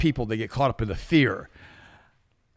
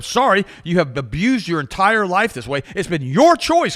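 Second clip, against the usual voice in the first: stern lecture; 4 semitones higher, 4 dB louder, slower than usual. Retold or imitated. Lecturing brother slowly.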